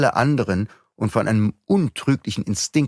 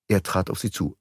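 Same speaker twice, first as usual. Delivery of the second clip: ordinary delivery, nothing marked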